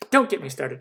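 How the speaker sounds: ordinary talk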